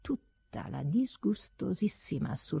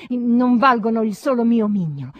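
a loud outburst of wounded pride; defiant shout; 5 semitones higher, 12 dB louder than usual. Acted. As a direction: build to a loud cry of contempt. Imagined defiant cry.

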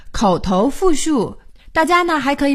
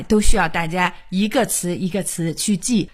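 ordinary talk, nothing marked

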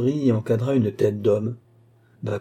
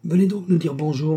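mild, higher-pitched call; 6 semitones higher than usual. A narrator's reading. Cerise inviting the young man at his window, calling out. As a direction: call lightly to a neighbour. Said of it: "cria au jeune homme".

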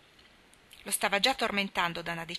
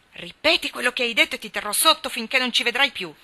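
louder, higher threatening yell; furious shout; 5 semitones higher, 6 dB louder than usual. A narrator's reading. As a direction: roar the threat down at him. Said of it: "gridò Dan". (grido minaccioso, più alto e forte)

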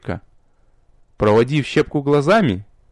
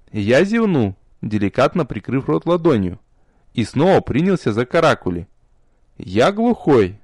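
usual speaking voice

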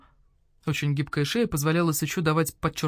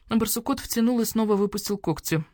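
usual speaking voice

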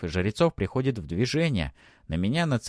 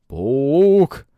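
loud, high angry yell; bristling shout; 6 semitones higher, 11 dB louder than usual. A narrator's reading. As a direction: spit it out in fury. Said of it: "ерится Уилла".

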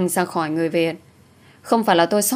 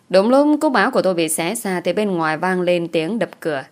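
ordinary delivery, nothing marked

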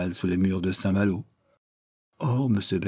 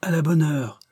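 crowd cheering; 8 semitones higher than usual. A narrator's reading. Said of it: "répétèrent deux ou trois cents voix".

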